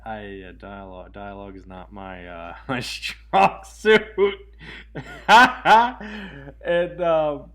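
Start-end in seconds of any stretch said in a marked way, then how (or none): 2.7-4.0 louder, slow words breaking into laughter; laughing. Real, not acted. Cracks up admitting weak dialogue. 4.1-6.6 loud, high burst of laughing; real laughter. Real, not acted. Laughs at own weakness.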